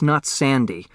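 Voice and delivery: ordinary delivery, nothing marked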